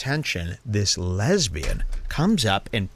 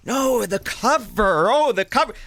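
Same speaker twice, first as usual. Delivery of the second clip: mock disappointment; 10 semitones higher than usual. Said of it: "your friends are going".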